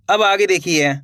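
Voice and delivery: usual speaking voice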